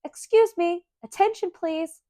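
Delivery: high, bright call for attention; polite announcement. Acted. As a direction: announce it politely like a little girl. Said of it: "then politely said".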